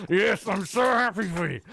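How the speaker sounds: sarcastic, laughing, high fake joy; mock happiness.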